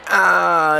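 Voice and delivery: high, drawn-out "ah" yell; yelled wipeout cry; loud yell. Real, not acted. Crashes into the berm.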